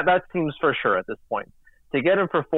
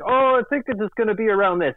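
higher-pitched puppet-like imitation; mild mock reporter voice, pitched up; Kermit; 9 semitones higher than usual. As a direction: quote him in a light, raised frog-puppet voice. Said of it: "I use my Kermit voice".

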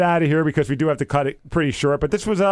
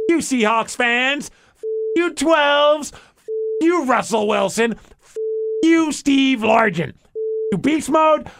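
all-caps rant, slower and higher; shouting capitalized words; 6 semitones higher, slower than usual. Acted. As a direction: read every word like it's in caps. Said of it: "written in all caps to prove how unhappy he was".

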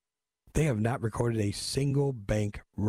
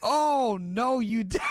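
usual speaking voice

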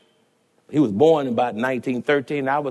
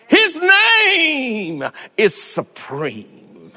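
slow, high, crying preacher's whoop; preaching at full force; 10 semitones higher, 4 dB louder, much slower than usual. Acted. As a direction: stretch each phrase out in a sung, tearful climb. Preacher whooping climax on His name.

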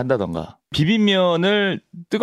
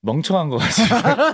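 laughing; 7 semitones higher, 3 dB louder than usual. Real, not acted. Joking insult about Danaka.

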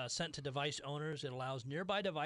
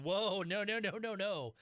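alarmed protest; 5 semitones higher, 4 dB louder than usual. Retold or imitated. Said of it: "people are going".

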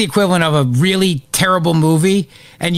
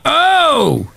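excited yelp; 10 semitones higher than usual. Real, not acted. Cheering his own joke again.

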